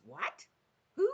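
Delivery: startled questions, rising at the end; father taken aback on the phone; funny, startled voice. A narrator's reading. Father hearing who is calling, voice gone funny. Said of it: "his voice goes all funny".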